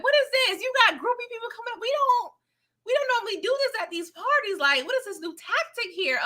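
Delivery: mock an annoyed celebrity fed up with groupies. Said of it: "[name] was like".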